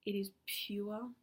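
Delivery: slow, soft awed whisper; hushed awe. Real, not acted. Inviting viewers to abundance, in a slow, soft whisper. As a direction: let it fade into a whisper of wonder.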